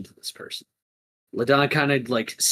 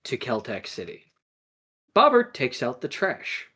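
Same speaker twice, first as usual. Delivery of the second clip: usual speaking voice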